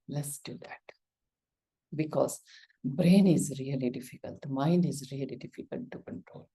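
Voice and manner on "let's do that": whispered aside. Real, not acted. Quiet resolve after okay.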